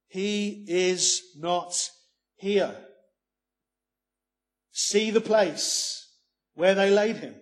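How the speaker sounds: very slow, loud, weighty scripture reading; emphatic. Real, not acted. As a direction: hammer each word home, slow and loud. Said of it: "You can't get more emphatic".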